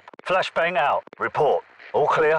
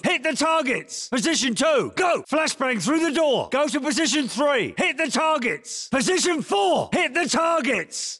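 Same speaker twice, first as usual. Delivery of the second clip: shouted drill commands; 12 semitones higher than usual. Acted. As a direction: yell each order at the recruit. Drill instructor calling commands.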